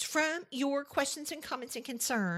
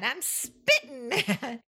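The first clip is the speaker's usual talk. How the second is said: mock mad. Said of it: "as we say in the South".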